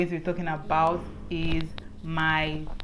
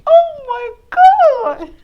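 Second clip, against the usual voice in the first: startled shriek; 17 dB louder, slower than usual. Real, not acted. Startled mid-vlog in the rain.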